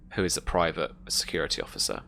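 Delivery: ordinary delivery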